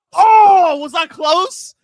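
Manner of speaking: very loud, high-pitched drawn-out cry; excited outburst. Real, not acted. Times a high five to the screen.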